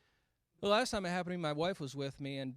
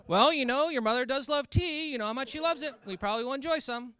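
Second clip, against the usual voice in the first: high, loud oblivious dad; dad talking about mom loving tea, high and loud; 9 semitones higher, 11 dB louder than usual. Retold or imitated. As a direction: answer sweetly like a clueless father. Dad answering son.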